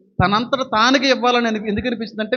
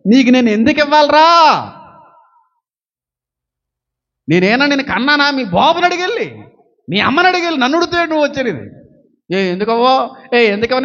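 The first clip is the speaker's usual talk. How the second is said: loud, high, indignant grandmother; scolding grandmother; 6 semitones higher, 5 dB louder than usual. Retold or imitated. Grandmother scolding the grandson over money, loud and high. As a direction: scold the grandson like his granny.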